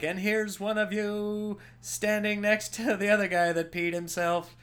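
high, lightly put-on presenter voice; souvenir photo presenter. Gift shop clerk showing a mugshot, put-on presenting voice. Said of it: "Maybe they're like".

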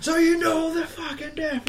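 high, strained imitation of a teacher's outburst; hammed-up high-pitched tirade; mock furious teacher. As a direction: explode upward, acting out the enraged teacher.

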